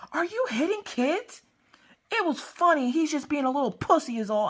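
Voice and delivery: grab him by the collar and scold him; big sister scolding her little brother; snippy teen sister. Retold or imitated.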